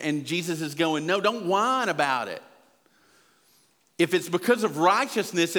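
full-force pulpit exhortation; preaching at full force. Acted. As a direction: preach it with rising fire to the congregation.

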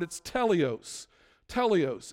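"teleos" said slowly as TEH-lee-os, stress on the first syllable; Greek word teleos. Shown as a demonstration. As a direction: spell out "teleos" slow and clear, TEH-lee-os. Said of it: "a Greek word".

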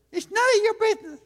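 high, fast, snippy woman; snippy lady voice. Retold or imitated. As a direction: snap it like an offended lady. Lady voiced dismissing survey.

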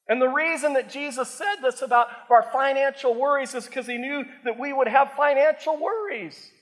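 strained, hoarse preaching voice; pushing through a hoarse throat. Real, not acted. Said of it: "I'm losing my voice".

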